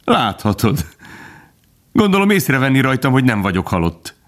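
high, light amusement; amused. A narrator's reading. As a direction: answer as if after a laugh. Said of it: "Gobó felnevetett".

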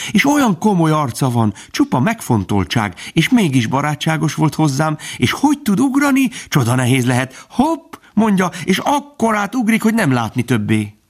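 high, excited fawn telling a marvel; Bambi. A narrator's reading. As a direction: keep gushing in a high youngster's voice. (magas, izgatott, csodálkozó gyermekhang)

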